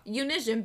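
"Unision" with a zh, rhyming with vision; nonstandard pronunciation. Shown as a demonstration. Co-host refusing to give a fuck, "Unision" with a zh. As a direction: say "Unision" as you-nizh-un, defiantly. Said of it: "I say unision".

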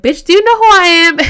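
high, loud joking indignation; mock self-important outburst. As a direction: shout it like a jokey big shot. Pulling rank as chair, joking.

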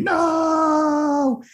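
screamed no. Retold or imitated. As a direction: shriek it like a horror victim. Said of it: "while they're screaming".

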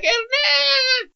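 very high mock girl squeal; squealing greeting. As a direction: imitate her excited high-pitched call. Excited girl spotting him, retold.

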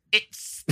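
"It's" whispered with a long hissing s; snake hiss on it's. Shown as a demonstration. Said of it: "sound like a fucking snake when you say it's".

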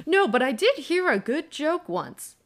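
Dumbledore telling a joke. Retold or imitated. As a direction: chime in jovially as the old headmaster. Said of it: "and Dumbledore goes".

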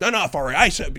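acted parental snap; snapping parent. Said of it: "You find yourself snapping".

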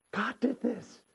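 hushed awe. Acted. Joseph realizing God's hand in it, hushed awe. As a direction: drop to a hush after the climax. Hushed repeat after shouted climax.

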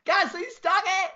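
high, loud shout of triumph; elated fan's victory yell, acted out; re-enacted screaming. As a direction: scream it out as your driver crosses the line. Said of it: "audibly I was screaming".

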